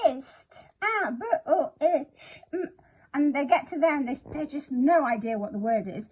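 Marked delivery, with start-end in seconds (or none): 0.1-2.7 "Ist, a, b, o, ich, m" as separate sounds with gaps; sounding out. Retold or imitated. Teacher imitating a child stuck on a word, sounding out. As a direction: segment each sound like a child decoding, higher pitch. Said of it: "a child who's going".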